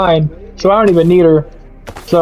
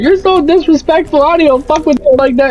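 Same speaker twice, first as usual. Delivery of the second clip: high-pitched, offended mock of the coworker; indignant coworker; 8 semitones higher than usual. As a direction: play her huffy and wounded, pitched way up. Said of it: "she got the nerve to be like".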